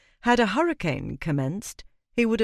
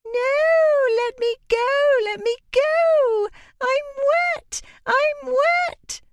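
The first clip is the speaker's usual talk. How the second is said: put-on very high, loud shrieking little girl; wailing child; 6 dB louder, much slower than usual. A narrator's reading. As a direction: shriek like a furious soaked child. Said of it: "It let out a piercing wail".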